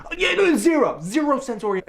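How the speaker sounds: high squeaky indignant zero; mock indignant. To an imagined interviewer about burritos, high indignant. Acted answer, outrage on zero.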